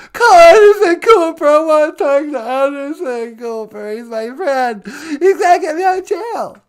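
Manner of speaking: loud, high whiny pleading; mock tearful demand. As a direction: demand help between fake sobs. Caller demands Anderson Cooper.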